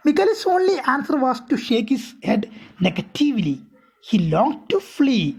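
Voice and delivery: a careful read-aloud for students; slow story reading. A narrator's reading. Teacher reading the English text aloud to her class, slow and deliberate. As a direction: read each English phrase slowly and deliberately.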